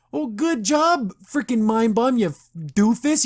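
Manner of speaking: high, drawn-out mocking praise; sarcastic congratulations. Real, not acted. Host scolding the villain who got arrested, sarcastic. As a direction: stretch out the praise, scornfully high.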